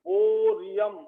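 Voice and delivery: Bohrium stretched slowly on a long first vowel; drawn out. Shown as a demonstration. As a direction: dictate bohrium slowly, holding each syllable. Teacher repeats name slowly for writing.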